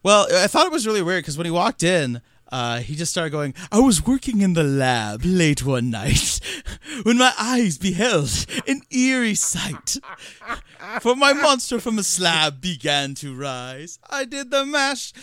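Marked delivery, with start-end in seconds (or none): 3.7-15.1 loud spooky crooner voice. Retold or imitated. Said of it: "he's doing the Monster Mash voice".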